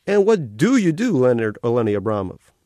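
cartoon otter greeting a new arrival, all sugary cheer; carnival otter voice. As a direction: gush like a cute carnival mascot.

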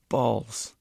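loud contemptuous retort; scoffing. A narrator's reading. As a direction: throw the word back scornfully. Lowboy scoffs again.

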